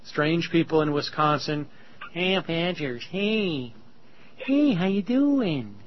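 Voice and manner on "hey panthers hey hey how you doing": drawn-out, slow Minnesota greeting, lightly put on; mock Minnesota accent. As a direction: tease the Midwest with a hammed-up Minnesota hello. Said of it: "You can imagine what he sounds like".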